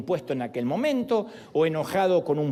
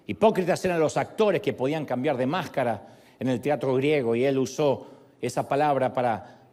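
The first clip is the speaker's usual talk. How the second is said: ordinary talk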